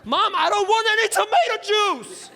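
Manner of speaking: high, loud outburst; losing it. Retold or imitated. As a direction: snap at mom, fed up. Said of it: "I lose it".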